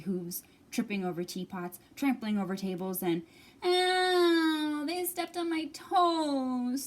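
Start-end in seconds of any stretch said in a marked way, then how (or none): 3.6-6.8 high, slow squealing cub; pitched-up cub squeal of pain; squealing baby bear. Acted. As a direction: squeal it like a hurt little bear. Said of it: "squealed Baby Bear".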